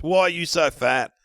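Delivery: a pointed jeer; mimicked heckler. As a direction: call it out like a heckler grabbing the mic. Retelling a heckler's jab.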